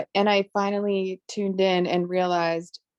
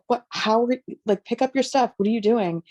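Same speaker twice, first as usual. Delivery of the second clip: higher-pitched mock frantic questions; imitating frantic; 5 semitones higher, faster than usual. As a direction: replay her own frantic nagging at the family, half-joking. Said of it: "And it's almost frantic".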